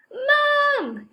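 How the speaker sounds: put-on high, loud child's yell for Mum; shrill-free loud yell upstairs. A narrator's reading. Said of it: "yelled Kipper".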